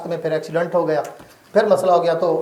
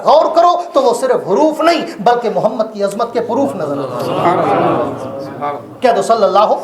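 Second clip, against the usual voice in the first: building sermon climax; 4 semitones higher than usual. Acted. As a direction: build to the big line. Preaching climax.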